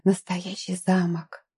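slow, soft, awestruck; awed breathy exclamation. A narrator's reading. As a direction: breathe it out in wonder. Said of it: "потрясённо выдохнула".

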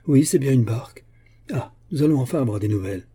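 eager and animated; excited sighting. A narrator's reading. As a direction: announce a long-awaited sighting. Excited sighting of awaited boat.

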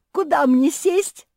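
high, loud, drawn-out question; eager yell. A narrator's reading. Мафи asks for a seat at the cake, yelling eagerly. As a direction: yell it in greedy excitement. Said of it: "завопила Мафи".

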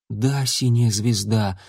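ordinary delivery, nothing marked

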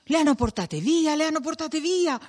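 high, urgent outcry; alarmed exclamation. A narrator's reading. Villagers realising the women were taken, alarmed outcry. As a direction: cry out the bad news twice.

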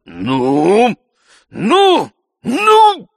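loud threats climbing to a very high yell; thundering. A narrator's reading. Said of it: "загремел он".

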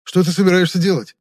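high, loud, alarmed demand; anxious high-pitched question; worried. A narrator's reading. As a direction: press the captor in growing panic. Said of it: "забеспокоился узник".

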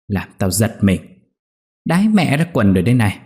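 put-on old mother-in-law startled awake, scolding her daughter-in-law; snapping scold. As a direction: grumble and tell her off, rising at the end. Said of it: "gắt ầm nhà".

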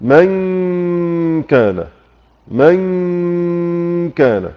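من كان stretched very slow, the نون hidden in a long nasal hum before the كاف; ikhfa demo. Shown as a demonstration. Teacher demonstrating hiding the نون in من كان. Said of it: "نقول مثلًا".

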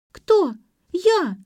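high, loud, affronted cries; indignant shout. A narrator's reading. Granddaughter called a weakling by grandmother, shouting high and indignant. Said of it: "закричала Ксюша".

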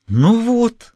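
a frightened man suddenly relieved; relieved babble. A narrator's reading. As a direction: grab at hope. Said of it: "обрадованно залепетал".

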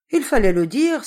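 loud, high outcry; exclaiming. A narrator's reading. Mistress reacting to the servant's Christmas plea, exclaiming. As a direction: cry out sharply, pitch jumping up. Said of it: "s'écria mademoiselle Solange".

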